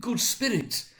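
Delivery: high, imploring appeal; pleading. Acted. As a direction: plead from the ground. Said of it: "he pursued".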